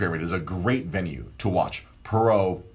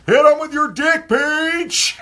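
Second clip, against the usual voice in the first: screaming at the top of his lungs, much higher and louder; full-throated yell; 9 dB louder, slower than usual. Retold or imitated. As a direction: bellow it at the ring from the stands. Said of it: "I yelled at the top of my lungs".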